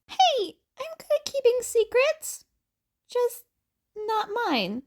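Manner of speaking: put-on high, playful teen voice; cheeky high protest. Teen defends himself.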